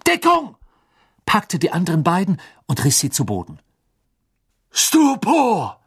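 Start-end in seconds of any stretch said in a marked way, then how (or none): 0.1-0.6 sudden, much higher, louder yell; shouted warning. A narrator's reading. Harry shouts a warning to his friends, a high loud yell. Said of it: "schrie er". 4.7-5.8 loud, much higher battle cry of the spell; thundering spell. A narrator's reading. Twenty wizards fire the stunning spell, a thundering loud cry. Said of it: "donnerten zwanzig Stimmen".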